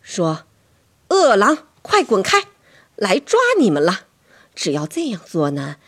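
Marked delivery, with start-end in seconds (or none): 1.1-4.1 high, loud command; forceful raised-voice threat; scaring off wolves. Acted. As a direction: chase them away with your voice. Demonstrates scaring wolves away.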